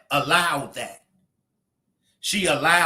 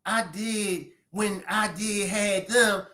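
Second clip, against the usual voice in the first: softly spoken, mealy imitation of a man without bass; mock him with a soft, drawn-out voice; mock weak-voiced man; 2 semitones higher, 5 dB quieter, slower than usual.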